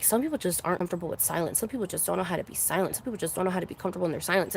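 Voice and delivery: low, quiet, fast mock meme voice; rushed, muted parroting of preachy posts; mocking recitation. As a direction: rattle it off dismissively under your breath. Mockingly reciting Instagram meme text.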